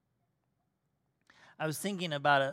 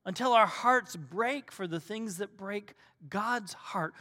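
ordinary talk, nothing marked

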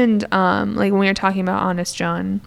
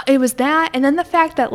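normal talking voice

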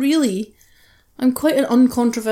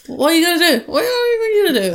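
exasperated shrug; 7 semitones higher, faster than usual. Real, not acted. Exasperated rhetorical question, rising pitch.

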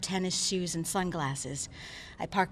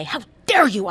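scream; 3 semitones higher, 8 dB louder than usual. Acted. Doctor screaming back at the protesters, furious. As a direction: throw it back in their faces. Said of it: "I scream".

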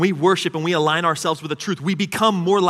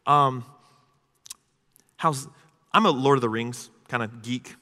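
usual speaking voice

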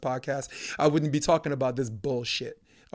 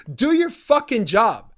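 angry demand; 5 semitones higher, 6 dB louder, slower than usual. Real, not acted. Ranting at the news network.

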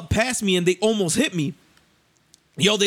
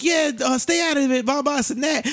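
high-pitched mimicry of a woman; mocking high retold retort; imitated girlfriend; 8 semitones higher than usual. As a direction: chime in from the passenger seat, pitched up. Imitates girl in passenger seat.

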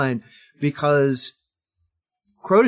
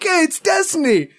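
radical surfer dude; 10 semitones higher, 4 dB louder than usual. Retold or imitated. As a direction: play a cheesy radical dude. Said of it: "that whole game was like".

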